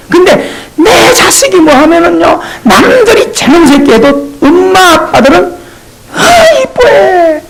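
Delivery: high, crying-out doting parent. Retold or imitated. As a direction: gush over your own child. Parent doting on own child.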